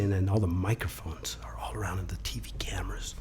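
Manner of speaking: storyteller pulling the audience in close; suspense whisper. A narrator's reading. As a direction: drop to a hush to build suspense. Suspense before the answer.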